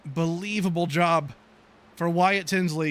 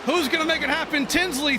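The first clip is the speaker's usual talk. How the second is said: brisk race call building toward the finish; racing commentator calling the last laps; live play-by-play; 6 semitones higher than usual. Acted. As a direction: call the pack as it jostles for position.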